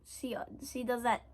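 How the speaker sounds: ordinary delivery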